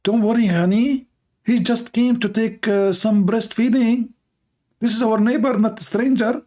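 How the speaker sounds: smooth it over like a sweet-talking wife; wife reassures her husband, high and coaxing. Acted. Wife answers husband, acted.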